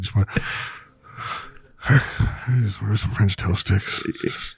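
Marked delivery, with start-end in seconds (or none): 0.3-4.5 defeated. Retold or imitated. A broken man orders at Burger King, slow and defeated. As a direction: sound like all hope is gone. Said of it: "total defeat in the man".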